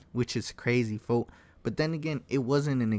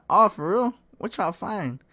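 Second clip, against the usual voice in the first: higher-pitched feigned innocence; mock-clueless, pitched up; playing it off; 7 semitones higher, 4 dB louder than usual. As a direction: act like you have no idea. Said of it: "try to play it off".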